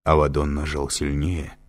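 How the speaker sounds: plain reading voice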